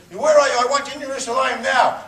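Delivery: demanding yell. Retold or imitated. Caller demanding he come to Jerusalem, loud high yell. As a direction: order him to come right now.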